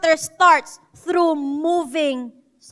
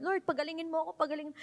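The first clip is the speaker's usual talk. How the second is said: mock weak prayer; 14 dB quieter, much faster than usual.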